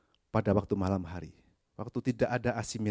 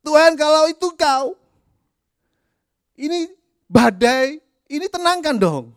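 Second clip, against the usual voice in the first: much higher, louder whiny demand; mocking Peter's demand; 11 dB louder than usual. Preacher mocks Peter demanding that Jesus calm the storm, high and whiny. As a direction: test God like a pushy child. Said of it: "Petrus berseru".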